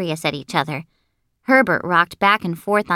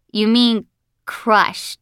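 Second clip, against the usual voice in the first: slow, deliberate "crush"; "crush" set apart and drawn out; correcting; 3 semitones higher, much slower than usual. Acted. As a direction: spell out "crush" slowly as a correction. Herbert corrects the misheard word.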